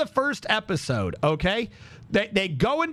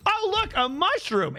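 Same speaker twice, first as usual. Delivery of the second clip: high-pitched mock girl voice; oblivious girl; 12 semitones higher than usual. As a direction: imitate a girl spotting something, pitched way up. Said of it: "the other girl goes".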